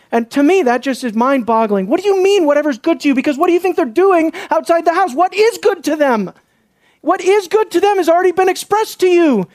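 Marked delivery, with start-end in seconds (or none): 1.9-9.5 high-pitched, incredulous challenge; really worked up, voice pitched well above usual. Real, not acted. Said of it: "I'm worked up about this".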